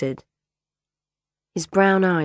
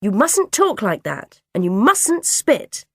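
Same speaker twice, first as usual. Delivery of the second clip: higher, louder, slow finger-wagging scold; dinner lady telling the boy off, slowly; 6 semitones higher, 6 dB louder than usual. Acted. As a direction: wag the finger with every word.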